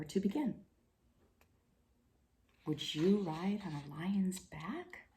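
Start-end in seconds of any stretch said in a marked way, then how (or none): 2.7-5.1 soft question. Real, not acted. Reader asks listening children.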